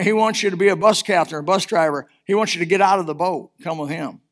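ordinary talk

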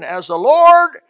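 sudden loud burst on As the Lord; king bursting out loudly; loud outcry. Retold or imitated. King shouting at the prophet's story. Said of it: "I got a feeling he said it, he said it loudly".

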